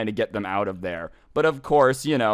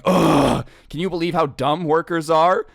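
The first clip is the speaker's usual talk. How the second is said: higher, louder imitation of a disgusted complaint; groan, then complain in a mock-exasperated mom voice; 5 semitones higher, 4 dB louder than usual. Mom griping to husband about workers, imitated exasperation. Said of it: "the mom is kinda like".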